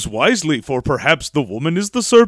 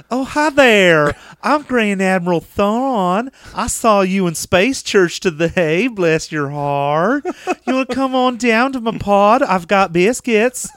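slow, higher Southern drawl; Southern accent; 5 semitones higher, slower than usual. Acted. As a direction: sweet-talk like a Southern host.

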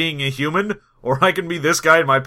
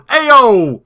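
higher, louder gym-bro holler; Jersey gym rat; 7 semitones higher, 7 dB louder than usual. Acted. Mocking the space dwarves' picture, Jersey gym-rat holler. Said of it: "you can already hear 'em going".

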